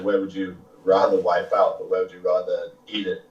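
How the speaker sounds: ordinary talk, nothing marked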